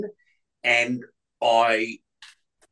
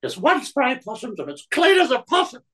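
high, far put-on dame voice; Dame Edna ad voice; 12 semitones higher than usual. Recreating his Dame Edna shower cleaner ad, high camp voice. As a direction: purr it like a camp grand dame selling. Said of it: "Clean shower or something like that".

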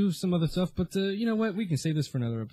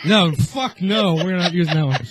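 laughing refusal; 11 dB louder than usual. Real, not acted. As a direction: refuse the take while cracking up.

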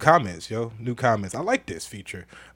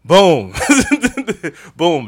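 usual speaking voice

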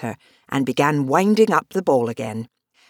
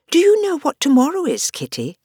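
lightly voiced young girl; bright girlish questioning; Alice voice; 9 semitones higher than usual. A narrator's reading.

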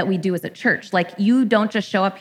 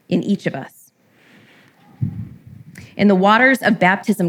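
closing prayer. Acted. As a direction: pray it over the congregation with pauses.

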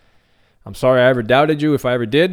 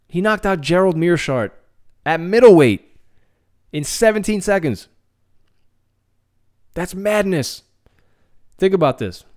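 amazed hype; 7 semitones higher, 4 dB louder than usual. Real, not acted. Hyping a fighter's fast knockout.

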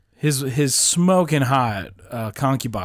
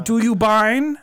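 mock Bible speech; 10 semitones higher, 4 dB louder, slower than usual. Mock archaic Bible talk.

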